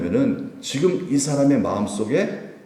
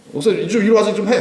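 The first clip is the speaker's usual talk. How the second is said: lightly voiced pushy congregant, higher and quick; mock summons, quick and raised in pitch; bossy congregant; 7 semitones higher, faster than usual. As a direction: order the pastor about, slightly put on. Congregant ordering the pastor about.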